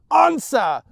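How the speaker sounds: loud, high command; furious shout. Acted. Mother demanding her son answer, shouting. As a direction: bark it at him.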